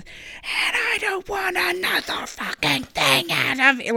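strained, slow growl held under a yell; reenacted throat-hurting snarl, slow and seething; guttural growl. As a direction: growl it out like a demon possession. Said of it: "the deep guttural growl".

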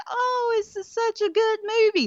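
high, sing-song fake praise; mock gushing viewer. Mocking viewers who call it good, high sing-song. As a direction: parody a fan swooning over it. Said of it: "just be like".

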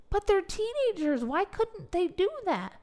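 incredulous. Retold or imitated. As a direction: ask it as if it's obvious. Retelling her own incredulous reply.

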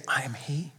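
soft unvoiced whisper of a holy secret; hushed whisper of a quiet revelation. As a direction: breathe the words with no voice. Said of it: "And did Jesus whisper it?".

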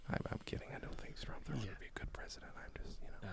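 very soft, whispered aside; hushed disclaimer. Real, not acted. Joker admitting they are kidding, hushed. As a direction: drop to a quick whisper to retract the joke.

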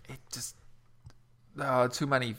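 measured recital of a sonnet; poem reading. Acted. Reading a sonnet to the podcast audience, measured recital. Reads Sonnet seventy-one aloud.

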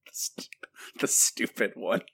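breathy whispered echo through a laugh; amused whisper. Real, not acted. Echoing the co-host's phrase in an amused whisper. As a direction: whisper it back, soft and amused.

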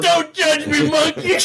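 high loud defensive whine; mock plea. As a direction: plead with the monkey, high-pitched. Acting out movie line.

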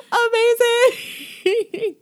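long, high squeal of delight; re-enacted squeaky-high thrill; voice shooting up. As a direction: stretch it out loud and sky-high. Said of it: "my voice went up, like, two octaves".

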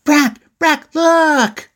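urgent high-pitched holler; acted boy shouting from further away. A narrator's reading. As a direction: yell the name twice, then stretch the last word. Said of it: "he hollered louder".

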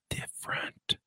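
slow hushed whisper, a sly joke tag; whispered aside. Acted. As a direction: drop to a whisper for the punchline.

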